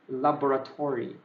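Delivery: laboratory as la-bo-ra-to-ry, every vowel sounded; full careful pronunciation. Shown as a demonstration. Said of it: "you have to pronounce it correctly".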